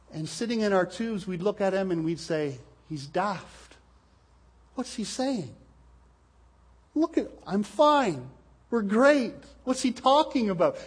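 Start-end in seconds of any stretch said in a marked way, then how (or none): 2.9-10.8 higher-pitched, puzzled dismissal; a hint of oblivious mockery; lightly voiced scoffers. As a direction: play carefree folk brushing off a warning. Oblivious tubers dismissing the warning.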